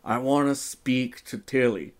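usual speaking voice

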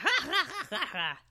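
high imitated cackle; put-on staccato "ha ha ha" laugh; weird laughter. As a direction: mimic a wrestler's weird, high-pitched laugh. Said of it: "he does that weird laughter".

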